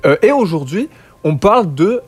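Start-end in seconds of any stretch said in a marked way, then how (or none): none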